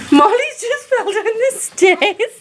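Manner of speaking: laughing through the words; amused, slow, giggly report. Real, not acted. Laughing while telling mishap.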